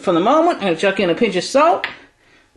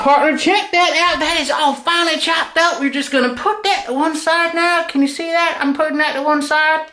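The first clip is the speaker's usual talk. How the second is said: put-on dodgy Texan accent. Texan tennis player hosting his cooking show, hammed-up Texan accent. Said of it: "let's get back into character".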